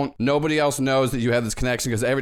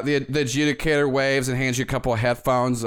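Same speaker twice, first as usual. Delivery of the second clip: ordinary talk, nothing marked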